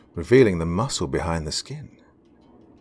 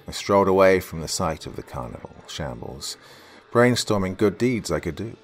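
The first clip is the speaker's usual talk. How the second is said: ordinary delivery, nothing marked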